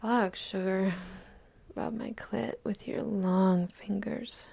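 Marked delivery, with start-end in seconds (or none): none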